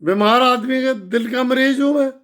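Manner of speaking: ordinary talk, nothing marked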